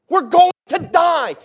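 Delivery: high, slow, drawn-out cry of alarm; intense panicked shout. Acted. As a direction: shout it out as if in mortal danger. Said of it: "probably some intensity there".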